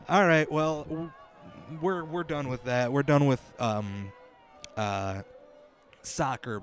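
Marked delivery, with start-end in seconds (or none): none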